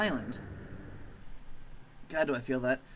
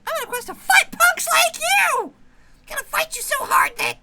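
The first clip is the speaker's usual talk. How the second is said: much higher, louder squeaky threat; squeaky tiny maggot; 10 dB louder than usual. Acted. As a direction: squeak a tough-guy warning.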